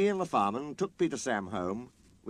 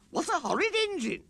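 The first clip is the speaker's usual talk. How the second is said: shrill offended coaches. Acted. Coaches voiced.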